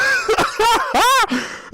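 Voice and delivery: a burst of high-pitched astonished laughter; high disbelieving laugh. Real, not acted. Caster stunned by the ending.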